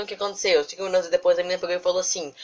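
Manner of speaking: usual speaking voice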